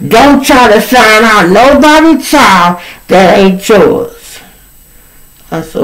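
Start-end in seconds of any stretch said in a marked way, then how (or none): 0.1-4.4 loud, high mimicry of her repeated warning; mock scolding at high volume; going hard. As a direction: imitate her shouting over you, pitched way up. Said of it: "she going hard with it".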